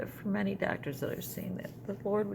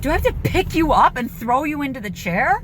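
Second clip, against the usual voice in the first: high, loud exasperated yell at mom; yelling at a stubborn mother; retold yell; 9 semitones higher, 10 dB louder than usual. Replaying her yell at mom, loud and exasperated. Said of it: "have a screaming fit".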